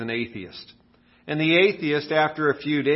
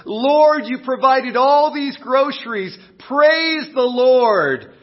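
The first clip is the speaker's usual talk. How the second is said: much higher, louder joyful shout; excited praise; 10 semitones higher, 7 dB louder, slower than usual. Acted. Widow thanking God for the groceries, excited shout. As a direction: burst out in thanks to heaven. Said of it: "she is so excited".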